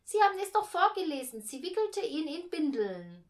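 put-on high, loud, fast child protest; insistent kid. A narrator's reading. Boy reminds mother what she read out, loudly insistent. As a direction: insist impatiently, pitched well up.

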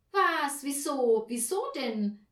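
loud, high surprised questions; astonished cries. A narrator's reading. Children react to Mama's hint, loud astonishment. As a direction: fire off startled questions like children. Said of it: "riefen die Kinder erstaunt".

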